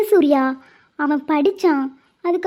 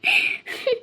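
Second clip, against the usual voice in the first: short high burst of laughter; 8 dB quieter than usual. Real, not acted. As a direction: laugh at his teasing threat. Wife laughs at his joke.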